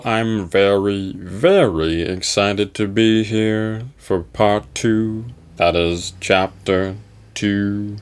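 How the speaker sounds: a drawn-out, tired put-on greeting; slow sleepy host persona; weary slow host voice. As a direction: talk like it has been a long day, slowly.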